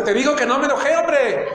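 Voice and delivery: irritated denial. Acted. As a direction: insist you're not angry while clearly angry. Acted argument, denying anger.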